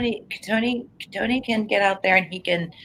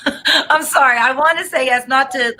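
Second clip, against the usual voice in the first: higher, laughing apology; apologetic laugh; 6 semitones higher, 4 dB louder than usual. Real, not acted. Psychic reluctant to tell client no, laughing apologetically. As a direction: laugh nervously into the sorry.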